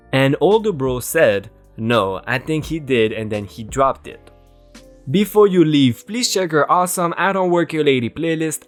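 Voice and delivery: ordinary delivery, nothing marked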